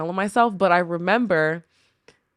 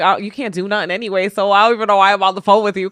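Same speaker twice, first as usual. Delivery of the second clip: louder, fast, brushing off the husband on the phone; dismissive retort; 6 dB louder, faster than usual. Retold or imitated. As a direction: snap it off quickly, like you're done with this call. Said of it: "I know sometimes I'm like".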